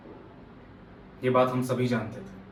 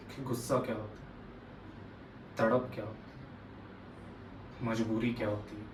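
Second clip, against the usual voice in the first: very soft, subdued listing; quiet grief, a pause after each phrase; hushed, sorrowful; 3 semitones lower, 11 dB quieter than usual. Acted. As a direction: drop the voice and let each phrase settle. Listing pains learned as a child.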